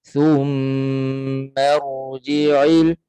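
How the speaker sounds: thumma rji'il drawn out very slowly; slow recitation demo. Shown as a demonstration. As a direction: chant thumma rji'il slowly as a Quran teacher.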